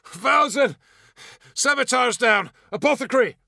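high frantic battlefield yells; screaming. Acted. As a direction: shout for the medic, desperate. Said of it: "someone was screaming".

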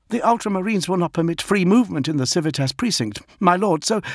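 high, hurried subordinate voice; protesting lieutenant. A narrator's reading. Redloss protests to the Lion, high and hurried. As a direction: object quickly and anxiously to a superior. Redloss objects to his lord.

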